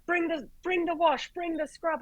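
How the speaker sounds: high-pitched flustered orders; mock panic. Doctor calling the charge nurse, mock panicked. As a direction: imitate a doctor going into panic stations. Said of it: "he starts panic stations".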